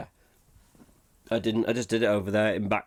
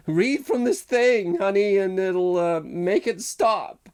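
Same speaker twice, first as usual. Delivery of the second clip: higher, louder, slow pleading voice; film character voice; 7 semitones higher, 4 dB louder, slower than usual. Retold or imitated. Host imitates a movie character pleading with a girl, higher and louder. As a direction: ham up the urgent plea.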